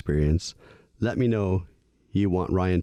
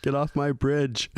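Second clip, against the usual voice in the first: cranky troll chasing folks off the bridge; shouting at trespasser; 7 semitones higher than usual. Pretend. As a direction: bark it at a trespasser. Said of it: "old wizard that just had it".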